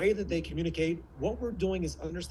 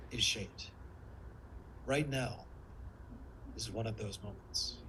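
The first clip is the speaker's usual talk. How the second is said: low, soft, with long pauses; slowed-down lover; 6 semitones lower, 9 dB quieter than usual. Shown as a demonstration. Coach moving the audience with lover voice, slowed down. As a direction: let each phrase sink in, low and soft. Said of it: "and I slow down".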